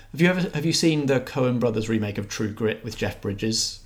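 ordinary delivery

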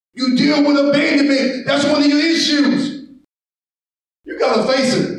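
driving, urgent pulpit delivery; impassioned sermon delivery; full-force preaching. Acted. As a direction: preach it hard to the congregation.

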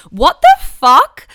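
slow, loud, drawn-out disbelief; incredulous outburst. Real, not acted. Exasperated outburst.

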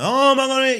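shrill panicked "oh my God"; hammed-up film-style panic; panicked gasp. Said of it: "It's not like in the films".

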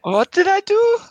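very high, bewildered mock plea; confused. Immigrant staring at officer who denied him, high confused voice. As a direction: squeak it out in baffled innocence. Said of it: "He looks at you confused".

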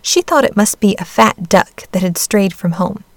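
ordinary delivery, nothing marked

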